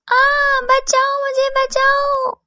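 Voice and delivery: high, drawn-out acted scream; crying out for help. Babli pelted by falling gold, screaming for help. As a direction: yell for rescue at a very high pitch. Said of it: "वो जोर जोर से चिल्लाने लगती है".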